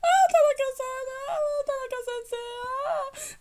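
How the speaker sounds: high, loud, weepy wailing of his name; mock tearful wail. Touched student wailing to the teacher in mock tears, high and loud. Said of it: "I'm crying".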